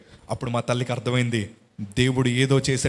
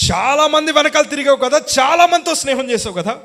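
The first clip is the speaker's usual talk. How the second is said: high, loud pleading mother; mother's emotional plea; 13 semitones higher, 7 dB louder than usual. Retold or imitated. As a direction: press him hard like a grieving mother. Mother pleading with dying son. (ఎక్కువ గొంతుతో తల్లి వేడుకోలు)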